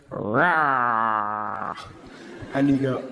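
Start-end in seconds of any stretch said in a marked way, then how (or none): 0.1-1.7 put-on kid's tiger roar. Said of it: "the kid goes".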